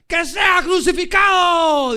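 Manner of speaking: very loud, high, drawn-out roar; crowd shout. Acted. Whole crowd answers Pilate on cue, huge drawn-out shout. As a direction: bellow it as a whole mob on the count. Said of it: "dijeron todos".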